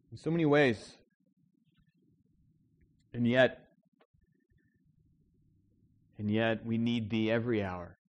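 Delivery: soft, hushed pulpit prayer; quiet prayer. Acted. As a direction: pray gently to God before preaching. Pastor prays before the sermon.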